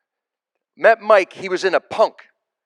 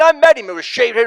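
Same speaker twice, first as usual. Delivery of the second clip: normal talking voice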